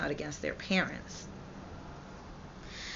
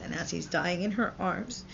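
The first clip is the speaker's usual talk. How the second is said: usual speaking voice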